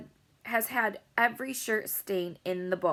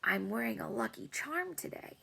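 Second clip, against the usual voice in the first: softer, secretive confiding; 4 semitones higher, 7 dB quieter than usual. A narrator's reading. Boy confiding his lucky charm to Lucille, softly. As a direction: lean in and share the secret softly. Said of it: "he said real soft".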